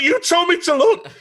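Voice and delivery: high, loud laughing protest; squeaky laughing "but you told me". Retold or imitated. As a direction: laugh it out, pleading innocence.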